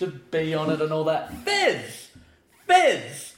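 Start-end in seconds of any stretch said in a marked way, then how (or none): 1.4-3.3 high-pitched call across the room. Acted. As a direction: shout the name to get his attention. Calling out a friend's name.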